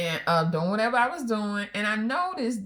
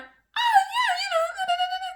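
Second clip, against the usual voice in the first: very high, gushing mock voice; high-pitched voice; 3 dB louder, faster than usual. Wife mimics teller's high-pitched gushing reply. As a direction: squeak it like a smitten girl. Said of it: "responded in a high-pitched voice".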